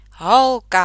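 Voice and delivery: a loud, falling call of the word Holka. Acted. Doctor calling the girl, loud Holka. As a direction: bellow Holka at her.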